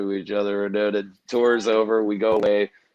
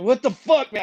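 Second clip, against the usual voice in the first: retold outrage; 12 semitones higher than usual. Retold complaint after being hit.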